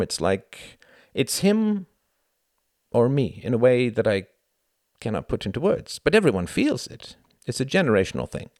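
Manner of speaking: a hint of a proud young German; lightly voiced Klaus. A narrator's reading. Klaus confiding in Tom on the walk, earnest.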